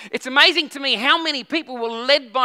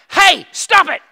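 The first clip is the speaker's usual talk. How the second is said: loud, higher sharp telling-off; in their face; 6 semitones higher, 9 dB louder, slower than usual. Retold or imitated. Dad confronting a misbehaving kid, loud stern command. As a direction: get right in the kid's face and stop them. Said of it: "get in their face and go".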